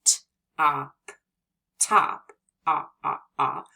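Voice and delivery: "t-op" split, then "top" and a run of open "ah"; sounding out top. Shown as a demonstration. Teacher sounding out a word for young readers. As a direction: break the word apart, then repeat the vowel. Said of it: "in the word top".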